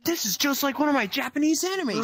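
high, put-on geek voice; excited game-character impression. Player repeating the anime line to Snake, in a high excited voice. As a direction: play an over-excited anime fan.

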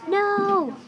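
high, drawn-out mock yell; mild storybook yell of protest. A narrator's reading.

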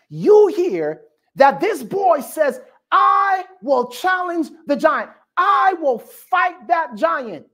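preacher shouting David's challenge to the church; preaching shout. Acted. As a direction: ring out the boy's defiance.

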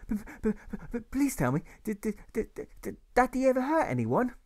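put-on high child voice, stuttering on but and did; a frightened boy begging for an answer; stammering boy. Boy pleading with his mother about his father, stammering.

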